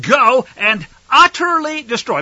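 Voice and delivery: utterly destroy, slow and punched; emphatic command. Acted. God's order to Saul, slow emphatic stress. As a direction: lean hard on utterly. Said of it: "Let me say that again".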